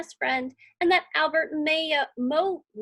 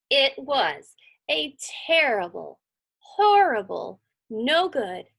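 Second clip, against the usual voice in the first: plain reading voice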